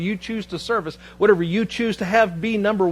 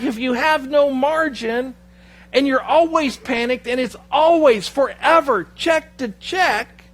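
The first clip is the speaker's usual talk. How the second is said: high, slow, emphatic preacher voice; fervent preaching; 8 semitones higher, 5 dB louder, slower than usual. Acted. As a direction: preach it slowly, high, with rising force. Preaching scripture at full force.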